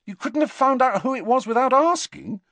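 Harry losing patience with her; frustrated. A narrator's reading. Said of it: "slightly frustrated".

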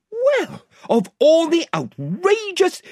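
put-on a boy protesting in outrage; outraged Edmund. A narrator's reading. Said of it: "began Edmund".

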